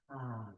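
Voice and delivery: long audible sigh out; sighing exhale. Shown as a demonstration. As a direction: let the breath go with a sigh. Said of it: "exhaling with a sigh".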